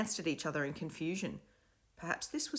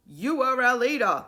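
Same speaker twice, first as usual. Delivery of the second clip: chorus reply; 8 semitones higher, 9 dB louder than usual. A narrator's reading. Kids answering in chorus.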